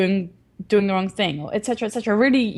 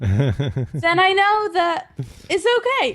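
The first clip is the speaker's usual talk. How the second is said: laughing delight; 8 semitones higher than usual. Real, not acted. Delighted punchline after her son's apology.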